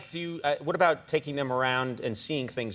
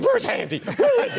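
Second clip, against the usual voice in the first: much higher, louder, laughing; playfully imitating the frantic looking; hammed-up where is he; mock search; 4 dB louder than usual. Host retells guest searching.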